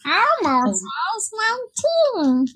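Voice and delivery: high, slow Elmo impression; bright puppet voice, drawn out. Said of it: "I could do that voice".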